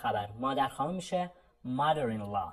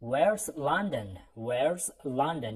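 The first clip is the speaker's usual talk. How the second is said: very slow model question; much slower than usual. Shown as a demonstration.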